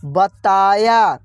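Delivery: बताया stretched very slowly; slowly drawn out. Shown as a demonstration. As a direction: sound out बताया for the class. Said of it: "क्या पढ़ेंगे?". (बताया को धीरे खींचकर बोलें)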